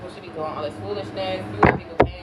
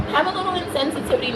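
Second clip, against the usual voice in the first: mocking commenter voice; 11 semitones higher, faster than usual. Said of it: "be like".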